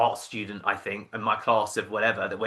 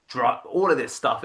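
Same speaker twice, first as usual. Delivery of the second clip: ordinary talk, nothing marked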